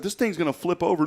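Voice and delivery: high-pitched mock alarm; retold panic. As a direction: act out your panicked warning in a high voice. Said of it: "and I was screaming".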